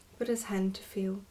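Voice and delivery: usual speaking voice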